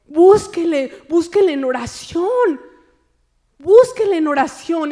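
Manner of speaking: loud, emphatic urging; preached exhortation. Real, not acted. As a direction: urge the congregation loudly to seek. Preaching at full force.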